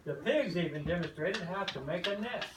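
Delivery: plain reading voice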